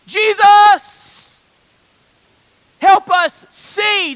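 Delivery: high, full-force drawn-out shout for help; loud, pleading holler; desperate cry. Acted. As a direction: cry out desperately across a crowd. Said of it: "are desperate".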